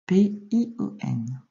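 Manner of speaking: slow, separated spelling; letter by letter. Shown as a demonstration. Teacher correcting a pupil's dictation, letter by letter. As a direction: spell it out with gaps between letters.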